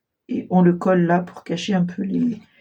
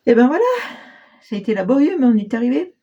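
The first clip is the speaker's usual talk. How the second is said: relieved; 5 semitones higher, 3 dB louder, much faster than usual. Real, not acted. Relieved finish of a long craft.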